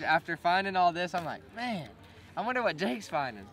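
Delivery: normal talking voice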